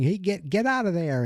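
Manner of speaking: high-pitched mock guard's order; guard calling out. Guards trying to stop fleeing girls.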